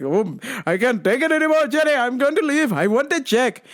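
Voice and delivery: high, hurried griping; mock dad's whiny protest; exasperated dad. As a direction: imitate dad at the end of his patience. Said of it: "He's like".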